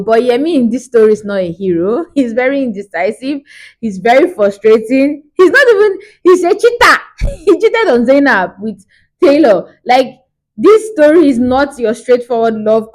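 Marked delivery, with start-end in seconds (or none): 5.4-7.9 high, loud outburst; exasperated accusation. Real, not acted. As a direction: blurt out the verdict on him. Exasperated verdict on the hero.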